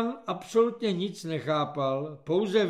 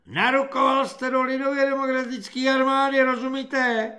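high, loud corporal's tirade; ranting threat; 8 semitones higher, 8 dB louder than usual. A narrator's reading. Corporal threatening a new recruit, furious high shouting. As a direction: rant at the recruit in a sustained high yell.

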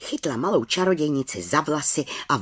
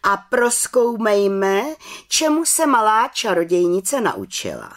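calling out; 8 semitones higher, 5 dB louder than usual. A narrator's reading. Head witch announces the examination to the council, calling out. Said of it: "zvolala vrchní čarodějnice".

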